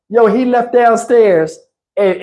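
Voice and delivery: usual speaking voice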